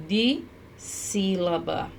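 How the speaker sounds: drawn out softly, syllable by syllable; slow syllable by syllable. Shown as a demonstration. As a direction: stretch out dissílaba slowly, splitting dis-sí-la-ba. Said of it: "ela é, ó".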